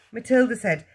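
ordinary delivery, nothing marked

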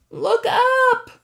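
high, loud alarmed shout; Grover's panicked yell. A narrator's reading. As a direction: scream the warning. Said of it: "Grover yelled".

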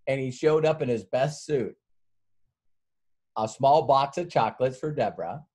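ordinary talk

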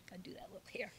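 muttered under breath, off mic; quick whispered aside to self. Real, not acted. Aside while fixing hair.

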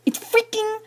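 high-pitched dismay; exasperated. Retold or imitated. Vlogger showing outrage over broken phone, exasperated. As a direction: squeak it out in disbelief.

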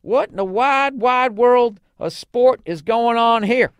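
high, loud bellow of a cowboy boss; Slim Pickens holler. Retold or imitated. Boss yells at singing railroad workers, loud hammed-up holler. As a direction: holler it at lazy workers who are goofing off. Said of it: "and says".

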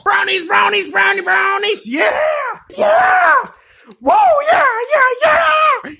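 high, loud celebratory shrieks; ecstatic screaming. Acted. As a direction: cheer at the top of your lungs.

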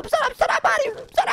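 urgent shout to Mario; urgent call. Real, not acted. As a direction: push him to hurry. Urging friend to hurry inside.

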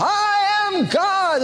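high, stretched mock affirmation; Shirley MacLaine. Woman trying to convince herself, mock high proclamation. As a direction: proclaim it hammed-up, as if convincing yourself. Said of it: "she's trying to convince herself".

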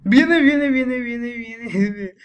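loud, frantic repeated warning; alarmed shouting. Real, not acted. Player warning that the pursuer is coming, loud alarm. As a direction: burst out in a rapid yelled warning.

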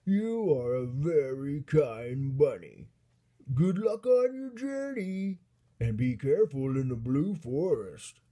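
loud, swooping, hammed-up giant voice; grateful giant. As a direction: give heartfelt thanks as a booming giant. Giant thanks the bunny.

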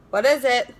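a slightly odd, put-on wondering; puzzled. Real, not acted. Puzzling over the tiny taped product, in a quirky voice. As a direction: ask it in a quirky, off-kilter way.

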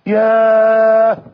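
one very long, held "ياه"; stunned sigh of realisation. Acted. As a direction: stretch it out as if struck to the heart. Young man struck by the question. (ياه ممدودة من أثر الصدمة)

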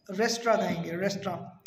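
"रेस्टोरेंट" as rest-raan, no t ending; corrected pronunciation. Shown as a demonstration. Teacher repeating the correction, rest-raan. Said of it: "इसे हम".

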